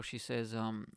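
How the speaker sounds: usual speaking voice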